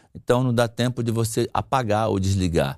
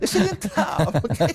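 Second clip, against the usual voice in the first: laughing; 8 semitones higher than usual. Acted. Laughing advice, very high.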